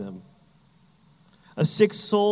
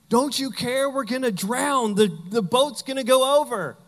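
higher, urgent mock panic; panicked plea; 7 semitones higher than usual. Disciples waking Jesus in the storm, panicked. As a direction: cry out over the storm in fear.

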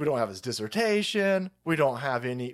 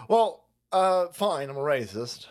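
normal talking voice

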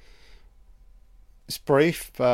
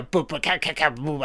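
beatboxing a breakbeat; 4 semitones higher than usual. Retold or imitated. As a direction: mouth a rap breakbeat, boo ka ka, higher than usual. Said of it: "You know that breakbeat".

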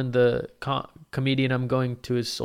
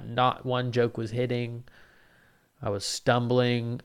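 ordinary talk, nothing marked

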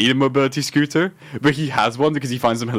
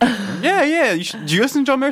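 put-on eager girl voice; 13 semitones higher than usual. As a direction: pitch it up like an excited fan asking. Imitating girl asking eagerly.

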